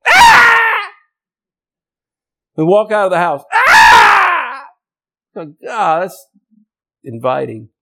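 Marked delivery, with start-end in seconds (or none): 0.1-0.9 very loud, high-pitched goat cry; imitated goat scream. Preacher showing how his goat talks, piercing loud scream. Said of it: "That's how he talks". 3.5-4.7 loud, high drawn-out bleat; imitated goat scream. Goat greeting people leaving the house, loud high bleat. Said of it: "That's how he talks".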